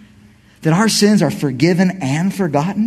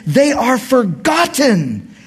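normal talking voice

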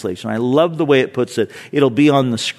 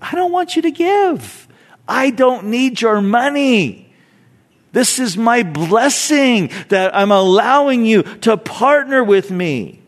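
lightly voiced God, higher at first; a hint of God's voice, higher and unhurried; voicing God; 7 semitones higher than usual. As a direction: speak as God, gently setting terms. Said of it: "this is what God would say".

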